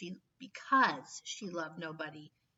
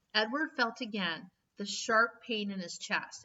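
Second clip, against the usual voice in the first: ordinary delivery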